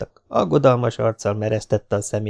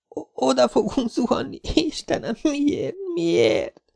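very high, breaking wail; terrified despair; 4 dB quieter, slower than usual. A narrator's reading. Jessica wails to God as the helicopter crashes. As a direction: cry to God as you fall. Girl sees trees, crash.

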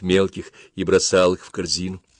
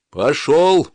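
loud guard's command; barked order; 7 semitones higher, 6 dB louder than usual. A narrator's reading. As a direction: bark it like a brutal overseer. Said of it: "приказал Тонг".

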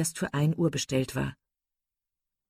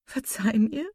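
higher, tentative plea with rising end; pleading; 6 semitones higher than usual. A narrator's reading. Marian asks her hurt sister's forgiveness, pleading. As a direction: beg forgiveness, lifting the last word. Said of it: "rief sie".

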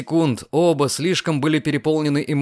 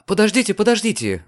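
high, fast hold on, hold on; flustered exclamation; 8 semitones higher, faster than usual. Acted. Diana fending off Lucian's eager confession, flustered and quick. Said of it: "воскликнула девушка".

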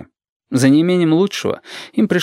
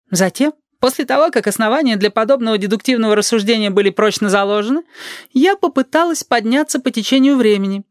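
high-pitched pedantic woman; acted schoolmistress explaining a theory; lecturing Teresa; 11 semitones higher than usual. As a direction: lecture formally in a high character voice. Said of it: "продолжает Тереза".

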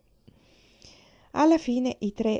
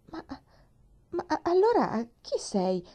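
confused stammer; 9 semitones higher, 4 dB louder than usual. Acted. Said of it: "sempre più disorientata".

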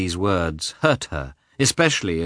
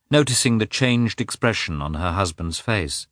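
plain reading voice

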